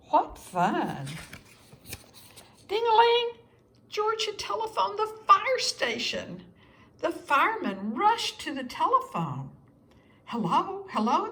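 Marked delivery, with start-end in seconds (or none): 2.7-3.3 high, loud imitated ring; phone ring. A narrator's reading. George's call ringing at the fire station, bright imitated ring. 10.2-11.3 firemen answering. A narrator's reading. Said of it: "they said".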